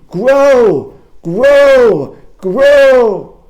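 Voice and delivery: high, full-voiced toad scream; loud, high drawn-out yell. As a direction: bellow at the seeds to make them sprout. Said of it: "he sees Toad screaming".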